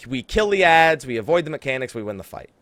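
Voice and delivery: normal talking voice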